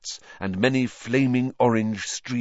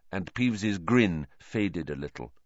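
usual speaking voice

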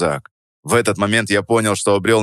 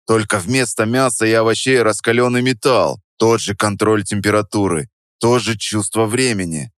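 plain reading voice

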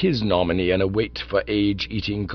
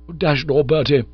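higher, fretful complaint; querulous; 5 semitones higher, 5 dB louder than usual. Acted. Bingo complaining to Bertie about the bed, querulous. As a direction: whine it like a put-upon friend. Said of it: "said young Bingo querulously".

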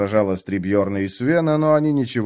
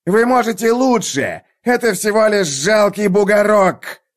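shouting; 9 semitones higher, 4 dB louder than usual. Acted. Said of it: "кричал сержант Хакон".

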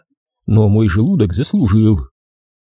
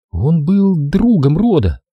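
higher-voiced woman, curt answer; brusque woman's retort, pitched up; sharp reply; 7 semitones higher, slower than usual. A narrator's reading. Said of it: "ответила Дел резко".

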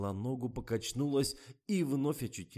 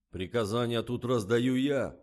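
slow, loud, raised assertion; firm reminder; 4 semitones higher, 9 dB louder than usual. A narrator's reading. Director reminds Zhorik who gives orders, firm. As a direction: put him in his place deliberately. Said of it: "напомнил Николай".

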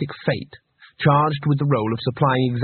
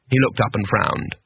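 plain reading voice

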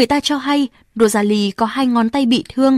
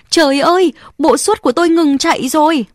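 alarmed cry; 6 semitones higher than usual. Acted. Girl cries out after injury.

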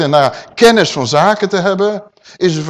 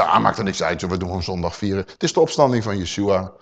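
dismissive mock voice; 9 semitones lower, 4 dB quieter, faster than usual. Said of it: "Dan zeggen ze".

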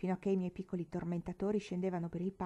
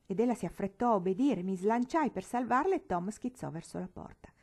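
plain reading voice

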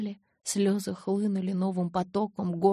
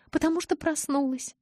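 sobbing, pitched much higher; 9 semitones higher than usual. A narrator's reading. Bella sobbing to Edward over waking up, voice high and breaking. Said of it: "прорыдала я".

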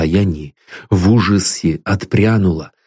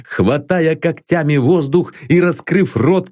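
ordinary delivery, nothing marked

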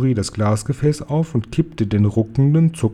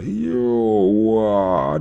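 drawn-out hammed-up howl; storybook giant wailing in pain; giant's roar of pain; 2 semitones lower than usual. A narrator's reading. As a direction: stretch the cry of pain right out. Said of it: "brüllte der Fleischfetzenfresser".

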